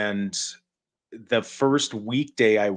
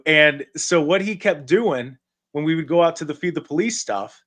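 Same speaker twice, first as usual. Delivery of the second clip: ordinary talk, nothing marked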